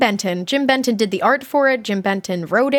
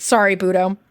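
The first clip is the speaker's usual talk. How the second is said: ordinary talk, nothing marked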